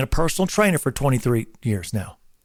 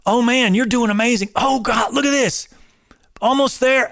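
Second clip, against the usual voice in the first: high, eager trainer hype; pumped-up cheering; 8 semitones higher than usual. Retold or imitated. Trainer to client nearing the last reps, high excited cheering. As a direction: lift them with every word, high and bright. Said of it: "the whole verbal thing of affirmation".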